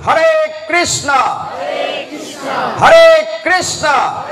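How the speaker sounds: very high, loud, slow drawn-out call; festival stage shout. Shown as a demonstration. Preacher showing his stage call at the chariot festival, drawn-out shout. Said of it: "আমি ওই টোনে তখন রথে বলি".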